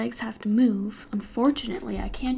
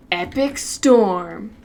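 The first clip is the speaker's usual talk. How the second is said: dramatic; 2 semitones lower, 6 dB louder, much slower than usual. Pretend. Dramatic announcement.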